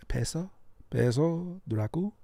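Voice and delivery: soft, tentative Spanish-accent attempt; put-on Spanish tonality. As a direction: try the name softly in a Spanish character voice. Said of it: "Why don't we just get in character?".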